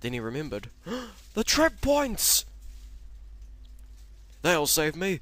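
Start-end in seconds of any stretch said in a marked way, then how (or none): 0.8-1.3 high sudden gasp of realization; sharp gasp. Acted. Henry remembering the trap points, sharp gasp. 1.3-5.2 much higher, louder hopeful shout, slow; excited cry. Acted. Henry realizing the trap points can save him, excited cry.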